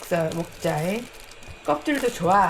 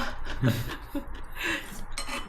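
soft airy giggle; quiet breathless laughing; breathy laugh; 6 semitones higher, 7 dB quieter than usual. Real, not acted. Laughing at Michelin joke.

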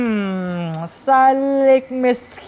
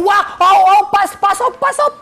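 louder, higher urgent call to stop; shouting warning; 8 semitones higher, 4 dB louder than usual. Acted. King shouting at his guard, urgent and loud. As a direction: yell out to stop her in time. Schreeuwde hij.

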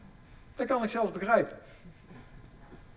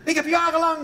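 higher and louder amazed delight; delighted discovery; 8 semitones higher, 4 dB louder than usual. Real, not acted. Comedian marvelling to the audience, higher and louder. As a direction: revel in the discovery.

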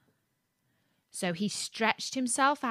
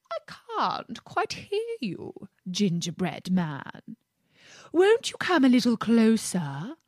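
sly fox; 3 semitones higher than usual. Acted. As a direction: lure him in slyly. Sly fox lures him.

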